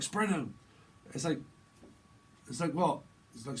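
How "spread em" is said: high, loud command; mock order. Someone getting arrested is told, mock loud order.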